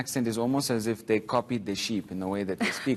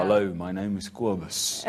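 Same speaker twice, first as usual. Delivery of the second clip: low-pitched Afrikaans man introducing himself; deep Afrikaans voice; 6 semitones lower than usual. Retold or imitated. Comedian imitating a deep-voiced Afrikaans man. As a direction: drop the voice low with an Afrikaans accent. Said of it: "that bass in their voice".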